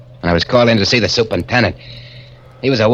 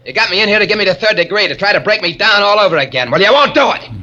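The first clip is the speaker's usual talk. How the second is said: hysterical shout; 8 semitones higher than usual. Acted. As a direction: scream it like a cornered animal. Hysterical man confronts superintendent.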